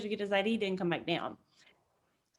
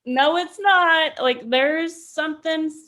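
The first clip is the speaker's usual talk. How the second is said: higher and louder, slow firm denial; insistent protest; 5 semitones higher, 5 dB louder, slower than usual. Retold or imitated. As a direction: push back hard, refusing the excuse. Arguing back at leaders.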